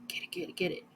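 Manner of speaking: breathy get it chant; whisper, willing it along; whispered urging. Real, not acted. Urging the eraser, unvoiced.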